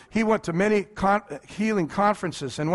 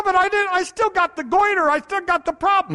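higher, louder plaintive protest; whiny objection; 11 semitones higher, 5 dB louder than usual. Retold or imitated. A sick man protesting to Wigglesworth, higher and louder. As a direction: protest like a sick man who wasn't healed.

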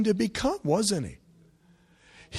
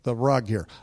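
doubtful congregant; 5 semitones lower than usual. Retold or imitated. Congregant doubting the pastor's message, low and doubtful. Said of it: "some of you looking at me like".

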